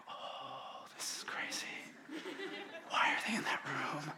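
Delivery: breathless stage whisper of amazement; awed whisper. Retold or imitated. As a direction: hush it like a stunned moviegoer. Audience reacting to a tense scene.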